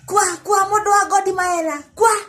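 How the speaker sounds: high, loud shouted chant of a passer-by; imitated shouting. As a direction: mimic his shouting, pitched well up. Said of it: "passes by shouting that".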